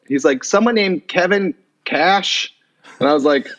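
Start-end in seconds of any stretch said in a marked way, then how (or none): 0.4-2.5 stepfather announcing. Retold or imitated.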